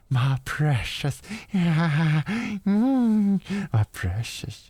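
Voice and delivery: slow, creaturely croon with a cackle; possessive creature voice. Pretend. Imagining Austin sitting with the shirt, hammed-up creature voice. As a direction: play a greedy creature doting on its treasure.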